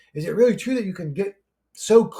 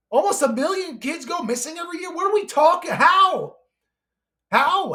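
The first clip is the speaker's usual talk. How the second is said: outraged rant; 8 semitones higher than usual. Real, not acted. Railing at listeners over missing children, high and outraged. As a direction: rail at the scale of it.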